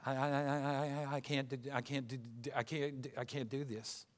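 soft, halting stutter on I and CAN'T. Acted. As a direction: stammer each word over and over, very quietly. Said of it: "Some people said he stuttered".

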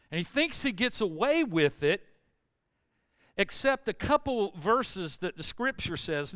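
loud, rising sermon delivery; preaching at full force. Acted. Pastor preaching loudly to the church. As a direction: preach it out to the congregation.

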